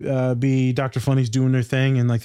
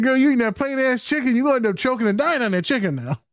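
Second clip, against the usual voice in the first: sassy teasing; 11 semitones higher than usual. Retold or imitated. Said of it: "I said".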